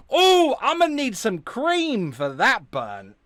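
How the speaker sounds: high, loud, drawn-out teasing; delighted mock wince; mock-wounded glee. Real, not acted. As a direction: revel slowly in a savage burn. Mock wincing at the burn.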